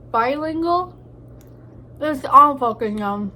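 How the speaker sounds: ordinary talk, nothing marked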